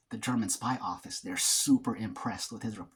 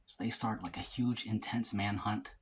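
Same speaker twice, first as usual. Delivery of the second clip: ordinary delivery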